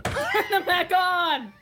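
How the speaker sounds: high, slow, softly echoed cry; play-acted desperate demand; mock outraged echo. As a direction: drag out a hammed-up plea from behind. Co-host echoes the mock outcry.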